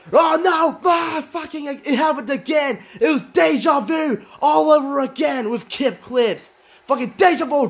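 real frustrated excitement; exasperated shout. Real, not acted. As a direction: shout it like a fan seeing the same disaster again. Same spot happens again.

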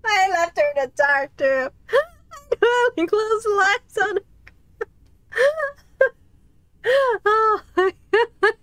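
high, teary laughing voice; words breaking into real laughter; crying with laughter. Real, not acted. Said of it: "I'm, I'm tearing up".

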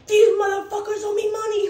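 high, loud tough-guy rant; loud mock gangster phone voice. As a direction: play the hard gangster demanding his cash. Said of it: "such a gangster on the phone".

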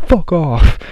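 put-on tossed off like a girl shutting him down; mock snapped brush-off.

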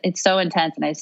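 ordinary talk, nothing marked

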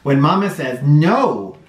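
put-on lively storybook read-aloud for little kids; sing-song picture book reading; reading to children. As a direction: read it like story time on the rug.

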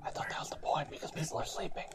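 hushed, airy whisper. Pretend. Co-host whispering because people are sleeping. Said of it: "that's why we're whispering".

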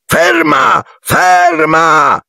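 very high desperate shouting; panicked shrill commands. A narrator's reading. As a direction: scream at the falling car to stop. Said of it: "gridò colpendo il cruscotto".

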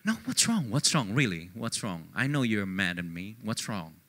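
soft, low, coaxing concern; mother probing her hurt son quietly; mom pressing gently. Retold or imitated. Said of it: "my mom said again".

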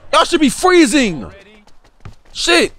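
slow, loud yell at the screen; drawn-out angry shout at teammates; frustrated shout. Real, not acted. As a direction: yell it out in disbelief. Frustrated yelling at the game.

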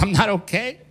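a hint of a sobbing wife; crying wife. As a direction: play the tearful wife with a touch of whine. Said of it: "She goes".

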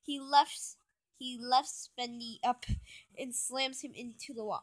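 ordinary delivery, nothing marked

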